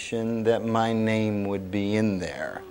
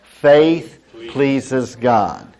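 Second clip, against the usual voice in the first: very slow and louder, word by word; leading group recitation; 2 semitones higher, 5 dB louder, much slower than usual. Shown as a demonstration. Preacher has congregation repeat with him, slow and loud. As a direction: lead the room through each word. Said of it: "Say that with me".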